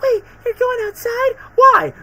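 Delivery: scientist. Retold or imitated. Lab assistant caught by Spider-Man, high squeak. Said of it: "she's like".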